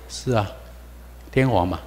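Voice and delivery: normal talking voice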